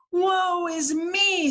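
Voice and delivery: high, slow theatrical woe; slow hammed-up lament; mopey crayon. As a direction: sigh it out dramatically. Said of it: "says the blue crayon".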